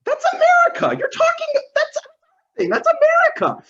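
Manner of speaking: very high squawk of outrage; sputtering, broken-off high objection; indignant protest. Acted. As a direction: protest in a leaping, high-pitched voice. Acted outrage at the freedom claim.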